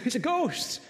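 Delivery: mock scared cry. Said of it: "so they said".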